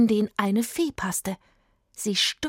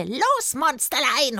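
high witch's command; raised, high-pitched wicked cry; witch calling out; 6 semitones higher, 4 dB louder, slower than usual. A narrator's reading. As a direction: call your monster to attack.

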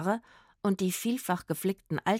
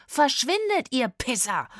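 raised-pitch shout to scare them off; angry yell; 6 semitones higher, 4 dB louder than usual. A narrator's reading. Trombonist chases off whoever disturbed their rest, angry yell. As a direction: yell it, starting high and dropping. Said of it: "und der andere schrie".

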